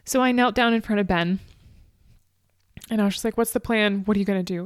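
fighting back tears while retelling; near tears. Real, not acted. Retelling kneeling by injured Ben, close to crying. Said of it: "I was gonna try not to cry on this".

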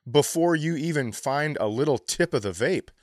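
usual speaking voice

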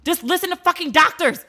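ordinary talk

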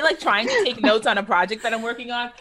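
usual speaking voice

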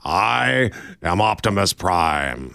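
deep, slow robot-hero voice; attempted movie-trailer deep voice; Optimus Prime. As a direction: drop low and draw it out heroically. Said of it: "deep enough to be the voice of Optimus".

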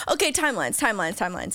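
ordinary talk

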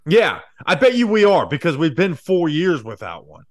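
loud sarcastic retort. Real, not acted. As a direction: scoff at it, loudly. Sarcastic comeback to quote.

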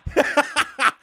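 high, loud burst of laughter; high laughing burst. Retold or imitated. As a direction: laugh out at the absurdity. After mocking the German boss.

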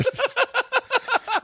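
크게 터지는 웃음; big laugh. Real, not acted. Laughs at dad's line.

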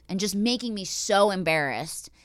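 normal talking voice

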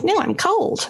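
future self complaining. Retold or imitated. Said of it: "I'm gonna be like".

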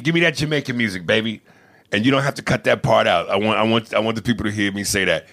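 ordinary delivery

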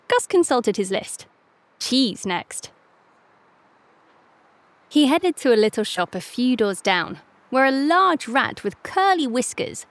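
usual speaking voice

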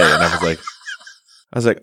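normal talking voice

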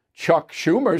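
ordinary delivery

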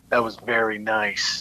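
low, slow, laid-back imitation; Jack Nicholson voice. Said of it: "And then he looks at me and goes".

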